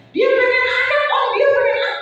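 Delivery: very high, loud, eager blurting; hammed-up busybody friends squealing to an elder; nosy friends. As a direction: pipe up insistently for a friend. Friends blurt out colleague's wish.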